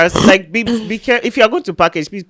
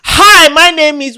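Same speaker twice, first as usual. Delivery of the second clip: louder, high, drawn-out greeting; mock introduction; 9 semitones higher, 6 dB louder, slower than usual. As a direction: overdo a slow, loud introduction. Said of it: "so you will now be there".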